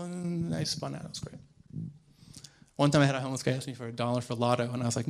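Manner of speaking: usual speaking voice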